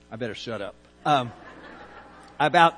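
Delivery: normal talking voice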